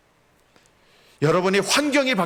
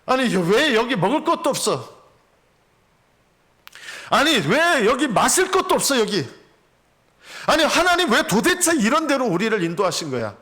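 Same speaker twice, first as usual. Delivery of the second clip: higher, louder grumbling protest; indignant complaint; 9 semitones higher, 3 dB louder than usual. Acted. Preacher voicing complaining Israelites in the desert, higher and indignant. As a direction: whine at God, fed up.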